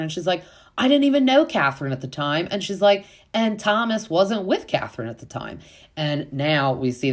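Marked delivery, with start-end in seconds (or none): 0.8-2.5 high, fast retold denial; indignant quoted retort; mock indignant protest. Acting out Olivia's defensive reply. 3.3-5.6 higher-pitched retold comeback; mock defensive retort. Acting out the defensive reply.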